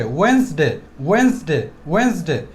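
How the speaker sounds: ওয়েডনেসডে contracted to "wens-de"; two syllables for ওয়েডনেসডে, no d before the n; shortened pronunciation. Shown as a demonstration. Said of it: "সংক্ষেপে". (ওয়েডনেসডে সংক্ষেপে "ওয়েন্সডে")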